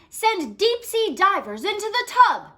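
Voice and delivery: a naval secretary shouting his fix; shouted order. Acted. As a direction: bark the order across the room. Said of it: "shouted the Secretary of the Navy".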